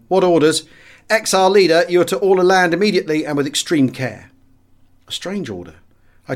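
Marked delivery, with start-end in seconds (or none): 0.1-0.6 loud, raised radio call. A narrator's reading. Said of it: "I called into my microphone". 1.1-4.3 loud, higher ground-control order; radio reply. A narrator's reading. Ground control answers over radio.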